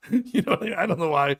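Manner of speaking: laughing through the words. Real, not acted. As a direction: giggle it out. Laugh heard.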